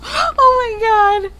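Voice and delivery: high, loud, drawn-out exclamation; real, high, slow outburst after laughing; overcome with amusement. Real, not acted. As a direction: wail it helplessly after a laughing fit. Helpless after laughing at her joke.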